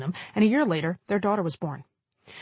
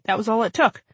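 ordinary delivery

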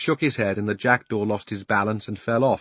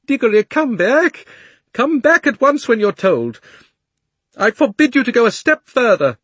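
higher, louder nervous shouting; half-hearted shout; 14 semitones higher, 9 dB louder than usual. Acted. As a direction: call him back but not too loudly. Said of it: "shouting, but by no means at the top of his voice".

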